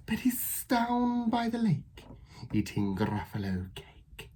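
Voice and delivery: high, sly snake voice. Acted. Snake tricking the Gruffalo's child, high and sly. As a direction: tease the child.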